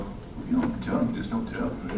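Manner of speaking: mock-hurt aside to himself; mock offended thought. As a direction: act slighted, half joking. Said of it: "I thought to myself".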